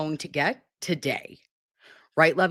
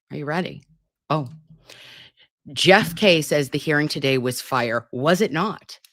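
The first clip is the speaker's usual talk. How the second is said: usual speaking voice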